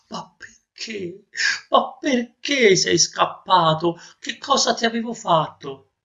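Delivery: high, drawn-out plaintive questions; hurt pleading. Acted. Master holding his returned nose asks why, pleading high.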